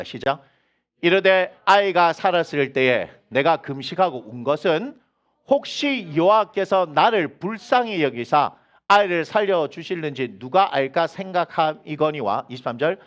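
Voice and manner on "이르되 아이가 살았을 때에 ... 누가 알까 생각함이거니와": slow, measured scripture reading. Shown as a demonstration. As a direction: read it out slowly, word by word. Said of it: "천천히 한번 읽어봅시다".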